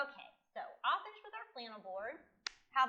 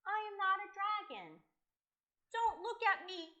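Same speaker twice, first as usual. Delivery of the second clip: raised, whiny storybook sadness; mildly upset boy; 6 semitones higher than usual. Acted. Boy giving up being a dragon, mildly mournful. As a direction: lament it with a mock pout. Crying boy explains himself.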